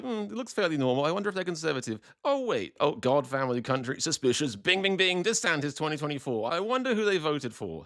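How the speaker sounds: mock wondering. Reader scanning a profile, mock-puzzled detective musing. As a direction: play innocent while spotting obvious clues. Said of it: "where you're like".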